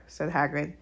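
ordinary delivery, nothing marked

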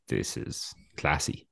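dry, quiet, slow sarcasm; slow hushed sarcastic remark; sarcastic aside. Retold or imitated. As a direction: murmur it deadpan, unimpressed. Sarcastic aside about plastic glass.

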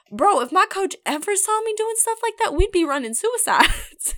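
put-on high, animated Southern accent. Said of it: "you just went into a really Southern accent there".

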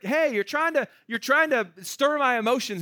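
hammed-up teasing protest; mock complaint. Paul teasing friends who make him cry, high mock protest. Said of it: "is what he's saying".

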